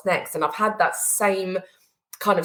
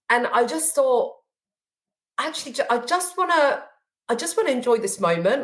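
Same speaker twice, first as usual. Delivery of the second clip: emotional, voice rising; 5 semitones higher than usual. Real, not acted. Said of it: "the reason I'm getting emotional".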